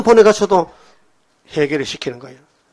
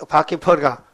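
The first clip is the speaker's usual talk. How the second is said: ordinary delivery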